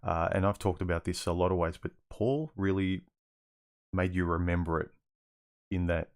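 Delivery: ordinary delivery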